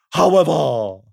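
slow, loud royal proclamation; pompous king. Acted. The king addressing the crowd, slow and pompous. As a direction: boom it out grandly and slowly. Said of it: "said the king".

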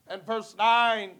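ordinary delivery

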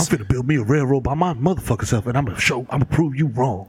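low, determined vow; deep-voiced mock film hero; defiant hero. Acts defiant John Henry in scene.

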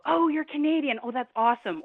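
lightly acted excited greeting; imitated delight. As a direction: gush like an impressed stranger meeting a traveler. Voices delighted people greeting Canadians.

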